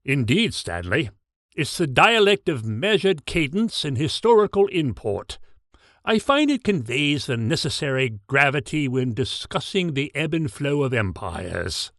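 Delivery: lightly voiced formal British accent; a hint of measured British gravitas; distinguished British accent, lightly done. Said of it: "almost formal British accent".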